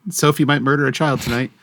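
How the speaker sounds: ordinary delivery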